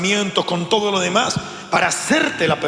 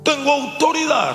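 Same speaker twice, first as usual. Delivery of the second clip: higher-pitched confident claim; alardeo del creyente confiado; 6 semitones higher than usual. Acted. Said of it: "sí, pero un día el Señor te dirá". (alarde confiado, en tono más agudo)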